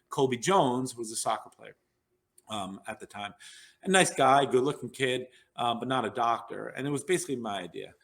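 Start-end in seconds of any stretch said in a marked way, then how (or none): none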